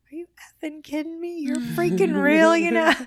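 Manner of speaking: high, loud, disbelieving exclamation; imitating a shocked friend at full volume; stunned outburst. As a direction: blurt it out as if God just showed up.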